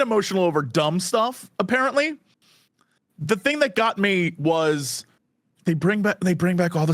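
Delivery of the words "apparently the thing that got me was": ordinary talk, nothing marked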